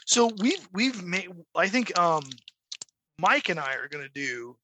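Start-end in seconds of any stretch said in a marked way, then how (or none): none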